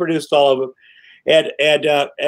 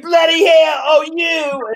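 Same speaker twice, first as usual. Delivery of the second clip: much higher, louder hammed-up British cry; mock British outburst; 11 semitones higher, 4 dB louder, slower than usual. Co-star scolding over the punch, mock British outburst. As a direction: shriek it as a shocked Brit. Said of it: "she's like".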